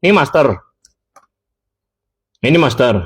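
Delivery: normal talking voice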